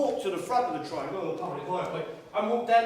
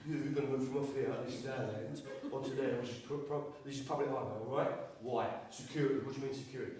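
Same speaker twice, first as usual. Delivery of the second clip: security chief; 6 semitones lower, 5 dB quieter than usual. Retold or imitated. Head of security lecturing the protesters, low and officious. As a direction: play a deadpan jobsworth laying down rules. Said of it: "head of security comes out and goes".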